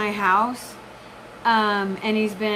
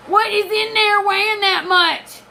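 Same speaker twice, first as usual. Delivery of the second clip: louder and higher, in disbelief; incredulous outburst; 8 semitones higher, 5 dB louder than usual. Real, not acted. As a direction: blurt it out in shock. Disbelief at her weight.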